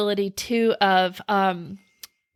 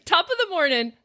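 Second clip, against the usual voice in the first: mock Irish; 7 semitones higher, faster than usual. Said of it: "super Irish".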